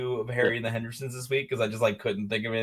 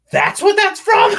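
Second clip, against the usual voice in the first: high, loud shout of discovery; hammed-up astonished yell; shouted realization; 12 dB louder, slower than usual. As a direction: reenact a sudden revelation at full volume. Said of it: "and I was just like".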